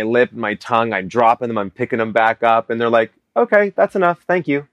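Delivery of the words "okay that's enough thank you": polite dismissal. Retold or imitated. Casting panel cutting off his audition.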